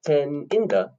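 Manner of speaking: TEN held, IN THE quick together. Shown as a demonstration. Teacher clapping the rhythm, long TEN and quick IN THE. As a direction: hold TEN, then hurry IN THE. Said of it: "the in the is faster".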